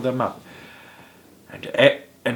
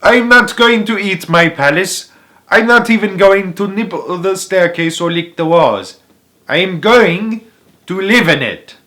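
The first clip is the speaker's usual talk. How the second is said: high, loud, haughty prince; a spoilt prince shouting his refusal; 9 semitones higher, 10 dB louder than usual. A narrator's reading. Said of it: "shouted the prince".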